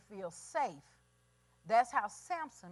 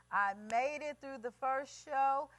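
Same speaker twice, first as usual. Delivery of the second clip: usual speaking voice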